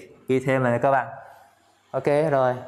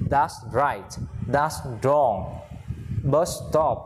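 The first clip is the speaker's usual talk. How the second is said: ordinary talk, nothing marked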